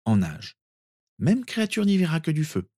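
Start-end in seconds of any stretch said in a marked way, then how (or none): 1.2-2.7 put-on high-pitched girl, pleased with herself; self-congratulating. A narrator's reading. Narcissa sure Créature will be fooled, self-congratulating. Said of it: "se félicita Narcissa".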